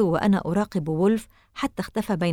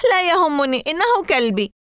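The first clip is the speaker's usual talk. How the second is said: put-on a high, loud boy's shout; 9 semitones higher, 5 dB louder than usual. A narrator's reading. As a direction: yell it out in protest. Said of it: "صرخت قائلا". (صراخ عالٍ وحاد)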